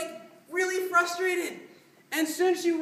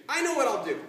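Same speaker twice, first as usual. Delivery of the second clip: firm, resolute line demonstrated for a partner; with conviction; 3 semitones lower than usual. Shown as a demonstration. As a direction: deliver it firmly, with conviction. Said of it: "say it with conviction".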